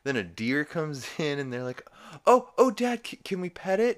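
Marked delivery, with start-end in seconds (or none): 2.3-4.0 high, loud eager plea; excited kids. Retold or imitated. The kids asking Dad about the deer, excited. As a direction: stammer it out eagerly, high-pitched.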